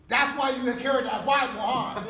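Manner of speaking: high, shouted mock realization; loud teasing. Homie teasing inmate about his Bible, loud high jeer. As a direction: crow it loudly at his expense.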